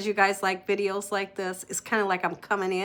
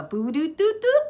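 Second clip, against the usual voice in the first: imitated chime; 7 semitones higher than usual. Imitating notification chime again.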